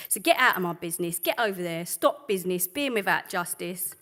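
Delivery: rhythmic, defiant spoken-word delivery; slam-poetry read with rising force; spoken word poem. Acted. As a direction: perform it like a protest poem on stage. Performs protest poem.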